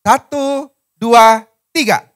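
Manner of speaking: high, loud, drawn-out count; rally them to read out loud. Real, not acted. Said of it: "Baca bareng-bareng yang rada kenceng". (aba-aba lantang dan panjang)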